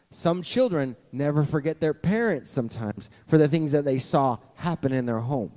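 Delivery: normal talking voice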